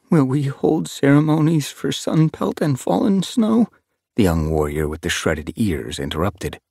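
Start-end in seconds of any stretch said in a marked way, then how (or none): 0.1-3.7 trembling with grief. A narrator's reading. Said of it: "His voice was trembling with grief".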